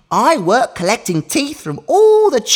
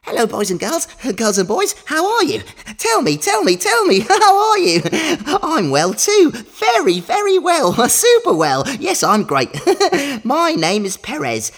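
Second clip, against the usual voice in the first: put-on overbright kids party host; 4 semitones higher than usual. Cheery mouse host greets children.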